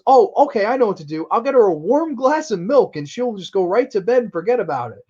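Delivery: untroubled mother. Retold or imitated. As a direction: play mom with an easy fix. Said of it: "And she goes".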